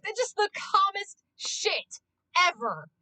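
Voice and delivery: usual speaking voice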